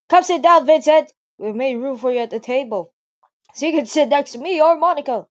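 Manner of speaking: high, loud and bouncy; bubbly excited girl. Acted. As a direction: call them over brightly. Voicing Sayori, loud and high.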